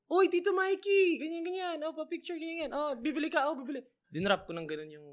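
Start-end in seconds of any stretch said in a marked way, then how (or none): 0.1-3.8 high, gushing fan cashier; excited cashier. Retold or imitated. Cashier recognizing Tito Mikey, high and gushing. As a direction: greet a celebrity customer eagerly.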